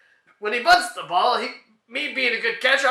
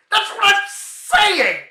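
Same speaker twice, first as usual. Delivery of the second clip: high, loud, slow protest; exasperated whine; 10 semitones higher, 4 dB louder, much slower than usual. Acted. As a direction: drag it out in disbelief. Exasperated insistence in comedy routine.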